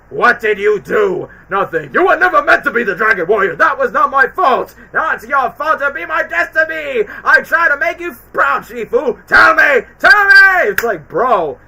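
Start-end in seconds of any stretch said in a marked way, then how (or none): 0.1-1.3 slow and much louder, each word pressed; mock angry shout, drawn out; slow furious accusation. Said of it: "when Oogway says". 1.5-10.7 building to a much higher, louder shout; mock villain screaming, rising to a yell; imitated furious shouting. Acted Tai Lung/Shifu quarrel.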